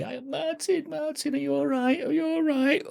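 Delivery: very high mock falsetto of worried women; fussing church sisters. Sisters fussing over injured Martin, high mock falsetto. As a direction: squeal it like fretting mother hens.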